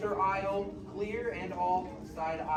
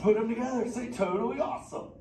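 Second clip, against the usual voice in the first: higher, louder surfer-dude turtle voice; a hyped, easygoing beach dude of a turtle; Crush the sea turtle; 4 semitones higher, 6 dB louder than usual. Acted. Sea turtle greeting the kids in the theater, stoked surfer-dude voice.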